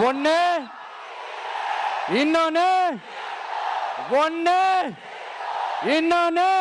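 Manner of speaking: rising rally-stage count; building emphatic count. Real, not acted. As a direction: hammer out the two choices like a rally chant. Counting the two contenders, rising.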